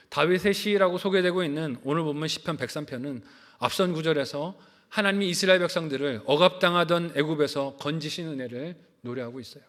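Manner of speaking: ordinary delivery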